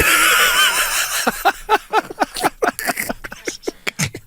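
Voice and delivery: high, breathless laughter; helpless high-pitched laughter; long laugh. Real, not acted. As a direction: laugh hard at the punchline. Laughs at punchline.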